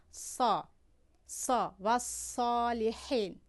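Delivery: thick ṣād in "صالحین", lips not pushed forward; heavy emphatic S. Shown as a demonstration. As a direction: darken the s in "سا" and "صالحین", drawn out.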